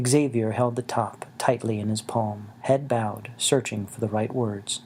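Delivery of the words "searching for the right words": ordinary delivery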